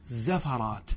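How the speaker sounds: repeated زفرات as "zafaraat", short a after ف; quiet "za-fa-raat" for زفرات; fatha on second letter. Shown as a demonstration. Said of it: "والقياس فتحها".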